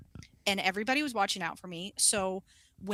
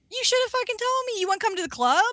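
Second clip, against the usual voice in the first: high, fast camp scolding; 11 semitones higher, much faster than usual. Retold or imitated. Client teasing her about skipping his club: high fast camp. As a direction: playfully scold like a flamboyant friend. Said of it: "he was like".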